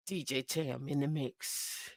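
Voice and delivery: radio DJ. Pretend. Host pretending to be DJ Tam on air, radio DJ patter. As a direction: swell into a mock station announcement. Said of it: "I'm D J Tam".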